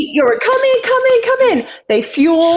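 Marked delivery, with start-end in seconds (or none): 0.4-1.7 much higher urgent repeated call; urgent radio call. Retold or imitated. Calling the driver in over team radio, urgent and high. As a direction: call the car into the pits.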